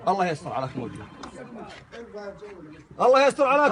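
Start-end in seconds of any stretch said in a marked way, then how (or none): 3.0-3.7 raised voice begging for calm; loud pleading. Real, not acted. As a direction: call out loudly to calm him. Heated quarrel, pleading calls.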